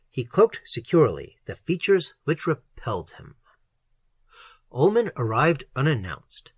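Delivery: plain reading voice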